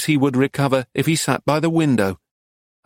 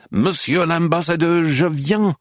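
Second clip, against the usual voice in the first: a mild mock strident call, higher; strident shout; 5 semitones higher than usual. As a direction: call out to the ambassador, understated. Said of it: "shouting stridently".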